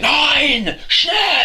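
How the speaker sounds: high, loud barked German commands; mock German shouting. Friend demos why German sounds angry with mock German yelling. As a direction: bark them out in a hammed-up angry German accent. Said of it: "I like the German accent just 'cause it's angry".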